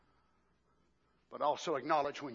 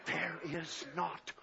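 sudden hush; 7 semitones higher, 6 dB quieter, slower than usual. Acted. Drop to hush after shouting.

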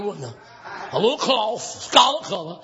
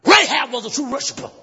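forceful shouted sermon with rhythmic pauses; full-force preaching; 6 semitones higher than usual. Acted. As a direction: declaim the story to the congregation.

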